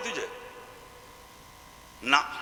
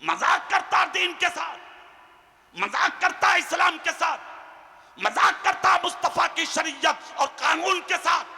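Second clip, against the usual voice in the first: fiery preaching; 6 semitones higher than usual. Acted. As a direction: rebuke them from the pulpit. Preacher rebuking the gathering. (ऊँची आवाज़ में डाँटती तक़रीर)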